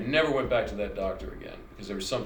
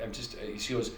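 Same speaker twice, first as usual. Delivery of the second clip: ordinary talk, nothing marked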